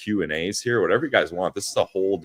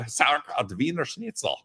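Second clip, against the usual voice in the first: put-on German toddler; 9 semitones higher than usual. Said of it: "I was just running around saying".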